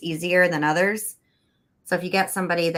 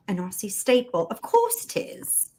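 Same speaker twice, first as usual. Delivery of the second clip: put-on a deliberately terrible Australian accent, half whispered; bad Australian accent; 2 semitones higher than usual. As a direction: toss off a clumsy Australian accent as a joke. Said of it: "my worst Australian accent ever".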